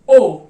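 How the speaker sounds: ओ held loud; long o. Shown as a demonstration. Teacher demonstrating a letter, then correcting it. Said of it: "इसको pronounce करते हैं हम ओ".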